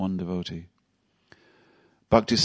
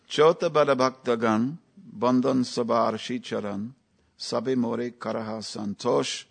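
verse recitation; 3 semitones higher than usual. Acted.

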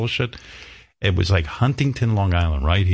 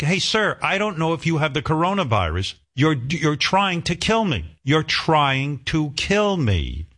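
higher, pleading voice, slowing on kill me; pleading reporter; 7 semitones higher than usual. Retold or imitated. Reporter begging a maskless protester to back away, higher mock plea. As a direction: play the scared reporter begging him to back off. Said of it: "And it's like".

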